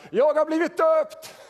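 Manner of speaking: high, loud, drawn-out cry; full-voiced yell, pitched far up; Martin Luther shouting. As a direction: shout it out as a defiant declaration, slowly. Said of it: "hörde Luther skrika".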